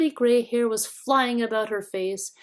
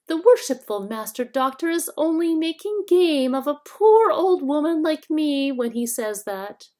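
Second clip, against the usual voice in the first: high, wheedling whine; shrill whining hag; 8 semitones higher, 6 dB louder than usual. A narrator's reading. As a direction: whine like a fawning old hag. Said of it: "the shrill, whining voice".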